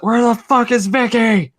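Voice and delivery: high, loud, slow mock rage; mock angry yell. Retelling a wrestler angry at a missed cue, mock loud yell. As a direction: bellow it like a furious wrestler. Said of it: "he's like".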